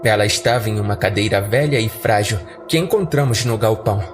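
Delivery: ordinary delivery, nothing marked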